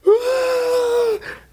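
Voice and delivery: high crying gasp, imitated; mock sobbing wail. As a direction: wail like a man bawling. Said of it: "this is what came out of his mouth".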